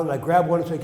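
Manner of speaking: ordinary talk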